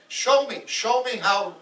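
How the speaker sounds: goading, emphatic repeated request; insistent demand. Acted. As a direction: press the demon insistently to show you. Thorstein goads demon to demonstrate.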